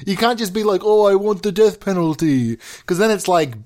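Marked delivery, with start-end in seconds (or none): 0.8-2.6 mock politician. Imagined leader stating unpopular opinion.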